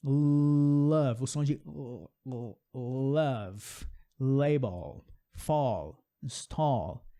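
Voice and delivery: love, label, fall, stall each with a clear English L. Shown as a demonstration. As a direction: land every L cleanly.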